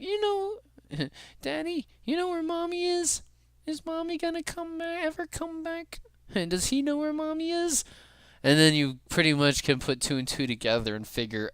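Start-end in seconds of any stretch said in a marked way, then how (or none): 0.0-7.8 put-on high, soft small-child voice; little kid voice. The little kid asking daddy about mommy, high soft child voice. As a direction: plead softly like a worried little kid. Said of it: "Little kid shows up, is like".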